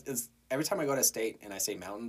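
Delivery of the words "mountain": "mountain" with the T left out; Colorado dropped T. Shown as a demonstration. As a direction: swallow the T in "mountain". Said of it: "we do not pronounce T's whatsoever".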